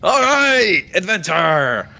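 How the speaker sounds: high-pitched eager cry, falling away; excited captain. Acted. Captain keen to get this underway, excited. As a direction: call out, keen to set off. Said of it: "he seems pretty excited".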